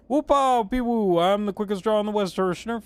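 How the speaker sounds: high, loud whooping cowboy; cowboy boast. Acted. Player playing quickest draw in the West, hammed-up cowboy. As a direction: crow it like a show-off gunslinger.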